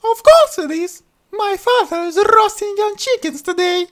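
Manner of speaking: very high, loud sarcastic princess; sharp princess. Acted. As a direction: cut him down. Princess retorts.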